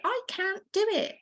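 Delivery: high, whining little voice of doubt; whiny self-doubt. Retold or imitated. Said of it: "when we say".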